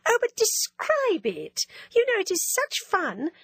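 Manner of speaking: a coquettish schoolgirl needling her friend; teasing Ginevra. Acted.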